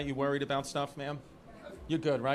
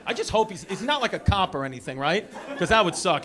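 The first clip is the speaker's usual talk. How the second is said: normal talking voice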